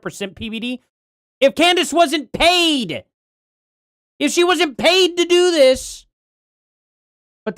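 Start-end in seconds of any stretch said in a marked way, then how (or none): 1.4-6.1 angry shout. Real, not acted. Streamer ranting back at a message.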